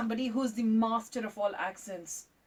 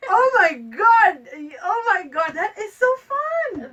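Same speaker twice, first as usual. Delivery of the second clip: loud, high, slow thrilled exclamation; excited squeal; 8 semitones higher, 11 dB louder, slower than usual. Real, not acted. Host thrilled after hearing Haryanvi, loud and high. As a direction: burst out in delight.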